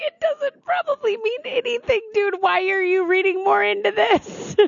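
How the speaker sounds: high, squealed retort; mock exasperation. Co-host reacting to his emoji puzzling, mock exasperation. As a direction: imitate an incredulous protest way up high.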